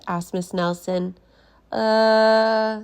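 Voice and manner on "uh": awkward hesitation. Acted. Kids stalling awkwardly.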